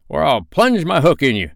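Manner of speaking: high, loud pirate captain's threat; cried threat. A narrator's reading. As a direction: menace the crew at full voice. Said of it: "cried Peter".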